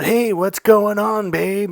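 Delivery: high, drawn-out come-on; imitated pickup line. Friend hitting on a passing girl, imitated come-on. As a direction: imitate a guy slowly calling out to a woman. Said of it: "He jumps up".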